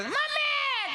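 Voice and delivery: put-on very high wailing child's cry; child crying mommy. Fallen child wails for his mother, very high. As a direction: wail like a toddler who fell. Child falls, cries for mom.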